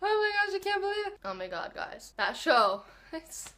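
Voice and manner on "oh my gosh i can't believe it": high, despairing; half-wailing about the ending; distressed. Real, not acted. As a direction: still lightly mock-crying. Still reeling over the finale.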